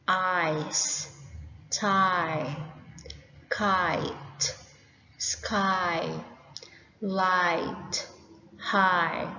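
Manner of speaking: word list with long "eye" vowel as in "tie"; each word alone, long I as in "light". Shown as a demonstration. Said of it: "Long I as in".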